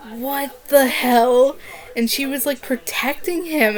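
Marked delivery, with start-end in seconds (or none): 0.1-1.6 slow, drawn-out disbelief; slow mock-outraged reaction; retold disbelief. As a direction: draw it out slowly, appalled. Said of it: "and I was like".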